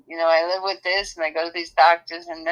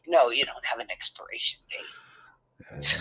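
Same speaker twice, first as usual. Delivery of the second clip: whispered, lower quote of the doctor; quoting the doctor; 8 semitones lower, 3 dB quieter than usual. Retold or imitated. Mother quoting her doctor's reply in a hushed whisper. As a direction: drop to a hush to quote the doctor.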